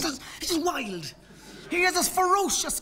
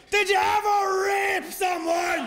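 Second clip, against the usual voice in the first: slow, high bellow; roaring; 7 semitones higher, slower than usual. Retold or imitated. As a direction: roar it out, deafened. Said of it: "he roars out".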